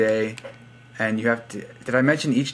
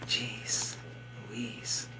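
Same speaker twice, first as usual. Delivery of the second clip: breathy whispered exclamation under the breath; whispered aside; 14 dB quieter than usual. Real, not acted. Vlogger reading a comment, whispering to himself in disbelief.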